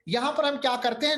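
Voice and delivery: normal talking voice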